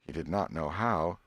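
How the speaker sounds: ordinary delivery, nothing marked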